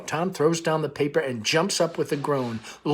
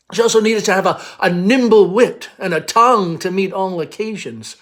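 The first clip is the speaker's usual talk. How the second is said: proud belle; 5 semitones higher, 10 dB louder than usual. Acted. Amanda lectures on callers.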